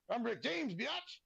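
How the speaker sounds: high, cocky catchphrase with biatch squealed up top. Retold or imitated. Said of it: "Have you ever heard the saying".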